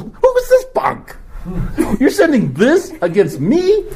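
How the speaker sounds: high mocking sneer at the boy; mocking Goliath voice, high and laughing; scornful giant. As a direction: sneer down at this puny kid, laughing. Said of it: "and he looks".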